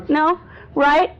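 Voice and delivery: usual speaking voice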